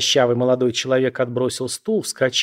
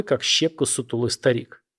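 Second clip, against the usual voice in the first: usual speaking voice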